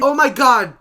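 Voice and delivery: loud amazed exclamation; excited. Real, not acted. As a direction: burst out in disbelief. Reacting to four roles.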